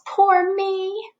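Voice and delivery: high, hammed-up little wail; mock wail. Bear huddled by a tree, high mock wail. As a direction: wail it like a lost, sorry bear. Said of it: "he wails".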